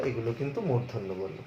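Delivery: usual speaking voice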